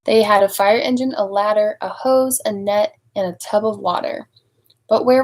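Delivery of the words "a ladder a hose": plain reading voice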